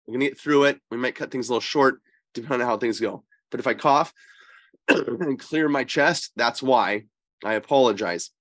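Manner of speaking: ordinary talk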